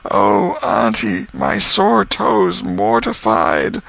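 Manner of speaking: slow, drawn-out put-on groan; milk the pain, slowly. Tom groaning to his aunt about his toe, slow and pitiful.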